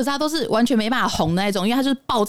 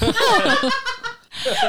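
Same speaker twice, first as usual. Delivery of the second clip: high giggle rising; high laugh. Real, not acted. Laughing at own remark. (高声笑出来)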